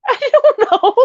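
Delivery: high laughing I don't know; words swallowed by a laugh; breaking up mid-answer. Real, not acted. Cracking up at mama.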